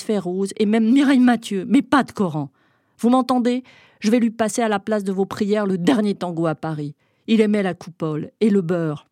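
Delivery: loud, mocking defiance; defiant shouting. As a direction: hurl it at the men outside. Keeps shouting through the door.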